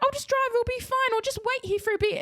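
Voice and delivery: high, jittery reassurance; flustered mum. Retold or imitated. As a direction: mum offering to swap seats, high and flustered. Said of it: "And she's like".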